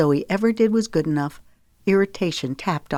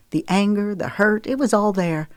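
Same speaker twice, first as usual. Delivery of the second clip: usual speaking voice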